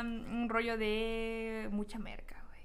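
slow conspiratorial whisper. Real, not acted. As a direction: whisper it like a secret. Joking aside about dating costs.

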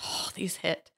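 whispered aside. Real, not acted. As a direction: breathe it out under the breath. Muttered aside while reading definition.

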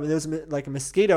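ordinary talk

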